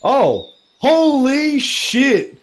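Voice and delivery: loud slow shocked outburst. Real, not acted. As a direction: caught off guard, blurting loudly. Shocked at a surprise attack.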